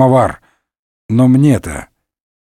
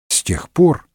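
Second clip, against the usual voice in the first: plain reading voice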